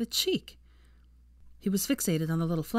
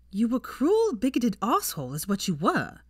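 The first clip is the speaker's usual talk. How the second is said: higher, louder, stinging accusation with CRUEL punched; bitter accusation; 5 semitones higher, 4 dB louder than usual. A narrator's reading. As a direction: throw the insult right back at him. Heroine accuses him in a quarrel.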